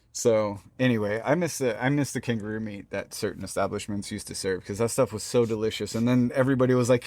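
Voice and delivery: usual speaking voice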